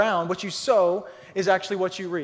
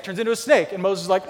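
ordinary talk

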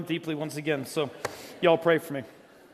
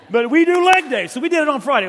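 louder, higher, punching leg day; emphatic insistence; 7 semitones higher, 8 dB louder than usual. Real, not acted. Pastor hyping the congregation about leg day, loud and high. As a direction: proclaim it like a rallying cry.

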